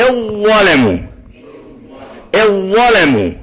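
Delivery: slow الظلم with a held, doubled ظ; merged consonant. Shown as a demonstration. Teacher confirms for students to repeat, merged consonant. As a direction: lean into the ظ of الظلم, drawing it out. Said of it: "أيوة كده".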